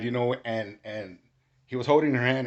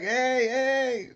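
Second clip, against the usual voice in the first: very high, loud calls; retold scream; 6 dB louder than usual. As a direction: holler to stop someone, pitch leaping up.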